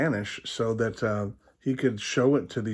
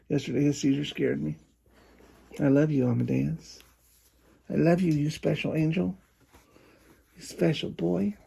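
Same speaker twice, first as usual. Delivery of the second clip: higher, cooing pet voice; 5 semitones higher than usual. Real, not acted. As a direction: coo to the dogs like babies. Talking lovingly to his dogs.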